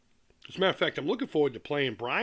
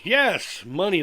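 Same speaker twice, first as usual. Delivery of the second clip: playful emphatic yes; 4 semitones higher, 7 dB louder, slower than usual. Real, not acted. Playful confirmation of joke.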